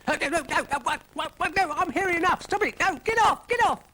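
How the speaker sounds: very high, loud no no no; frantic protest. Acted. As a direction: shriek and squirm away. Dougal protests the mixture.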